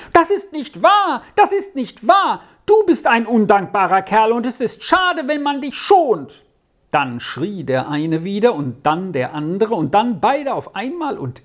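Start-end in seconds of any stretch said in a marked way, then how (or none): 0.1-6.4 put-on much higher, indignant boy's cry; shouted angry denial. A narrator's reading. Other boy answering the accusation, high shouted protest. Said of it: "so laut wie möglich schrie ein anderer".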